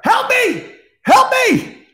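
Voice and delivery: very high, loud desperate cries; screaming for help. Acted. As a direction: shriek it like a trapped child.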